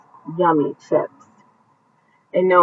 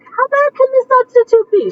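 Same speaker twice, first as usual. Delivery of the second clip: delighted surprise. Real, not acted. Delighted by story time.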